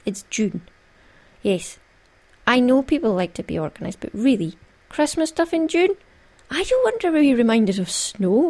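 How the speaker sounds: ordinary delivery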